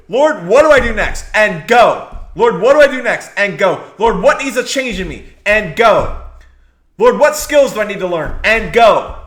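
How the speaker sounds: loud, punchy prayer-and-go cadence; loud rally cry from the pulpit; rallying preaching. Real, not acted. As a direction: fire them up line by line. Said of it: "rallying call and response".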